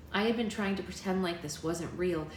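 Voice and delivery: ordinary delivery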